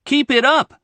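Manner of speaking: cheering on. Shown as a demonstration. Cheering on a team playing well: high, upbeat shout of encouragement. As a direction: call out "Keep it up!" like urging on a team. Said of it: "応援しているチームが良いプレーをしているときに".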